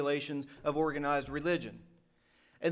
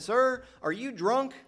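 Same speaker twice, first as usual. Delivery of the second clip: slow, higher-pitched retold question; pointed question; 7 semitones higher, 4 dB louder, slower than usual. Preacher retelling how he challenged a ranting caller, pointed and slow. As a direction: draw out the question, pitch lifted high.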